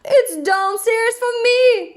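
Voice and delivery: high storybook holler; excited yell. A narrator's reading. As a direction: call it out high, stretching the end. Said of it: "yelled Fred".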